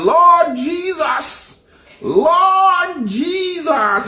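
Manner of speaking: high, very slow drawn-out cry of Lord Jesus; hammed-up pious Jamaican wailing to Jesus; mock Jamaican prayer. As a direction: wail it like a devout churchgoer calling on the Lord. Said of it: "the first thing they talking about is".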